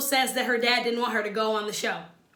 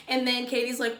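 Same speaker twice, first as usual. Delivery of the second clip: ordinary talk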